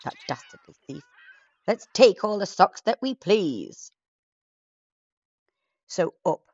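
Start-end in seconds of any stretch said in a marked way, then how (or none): 0.0-3.9 lightly voiced pirate chief; a hint of a greedy pirate. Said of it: "said the chief".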